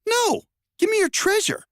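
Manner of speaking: very high greedy demand; high hero voice. Acted. Hero voice arguing with chest.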